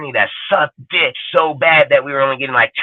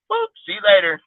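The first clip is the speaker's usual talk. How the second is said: mocking dismissal. Real, not acted. Mocking coaches getting fired.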